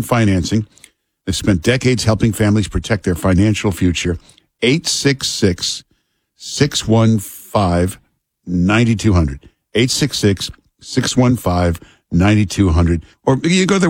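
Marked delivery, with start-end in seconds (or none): 4.6-9.5 each digit drawn out slowly; slow number read. Acted. Host gives the listeners the phone number, very slowly. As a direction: spell out the number slowly so listeners can write.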